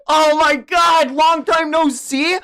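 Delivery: high, loud, drawn-out astonishment; shocked disbelief. Real, not acted. Reader stunned by a returning character's greeting, loud disbelief. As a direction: repeat it as a stunned, rising question.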